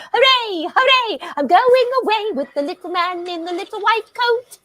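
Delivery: high, giddy singsong hooray; giddy cheer. Acted. Old star cheering her own exit, giddy and high. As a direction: cheer like being carted off happily.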